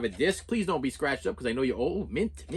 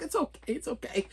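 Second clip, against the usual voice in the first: high, soft, strained politeness; polite fake okay; 9 semitones higher, 6 dB quieter than usual. To the dancer who bumped him, high soft forced okay. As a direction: say it's fine through clenched politeness. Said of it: "I'm like".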